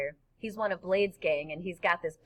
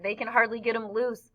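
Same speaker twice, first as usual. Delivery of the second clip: usual speaking voice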